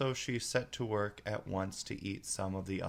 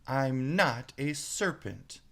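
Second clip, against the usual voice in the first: slow, louder, offended protest; indignant; 5 semitones higher, 5 dB louder, slower than usual. Acted. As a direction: insist hotly, leaning on not. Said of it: "said Alice indignantly".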